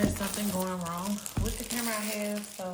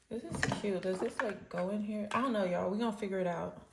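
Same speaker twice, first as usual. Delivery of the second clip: normal talking voice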